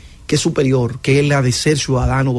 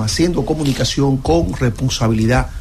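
usual speaking voice

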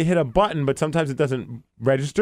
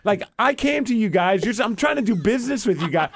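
exasperated, higher-pitched argument; retold heated pleading; 6 semitones higher than usual. Customer trying to do business, getting heated. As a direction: replay the argument, pleading your case in frustration. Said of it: "'cause I'm getting heated".

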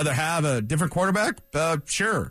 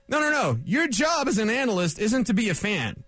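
ordinary talk, nothing marked

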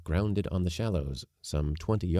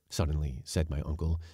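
ordinary delivery, nothing marked

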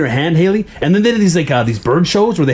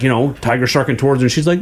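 ordinary delivery

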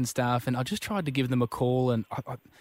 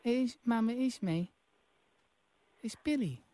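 high, quiet put-on child voice; little boy Billy; 8 semitones higher, 10 dB quieter than usual. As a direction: play a small English boy, soft and pitched up. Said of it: "Billy? Bill-, Billy".

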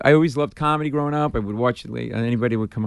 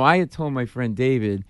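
normal talking voice

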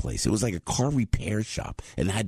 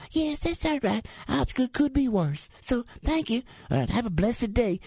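much higher, chirpy polite lady. Retold or imitated. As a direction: gush thanks, pitched way up. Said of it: "And go".

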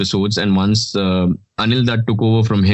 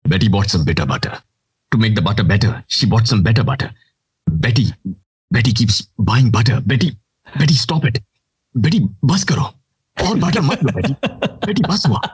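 Matt Damon impression. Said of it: "Matt Damon voice".

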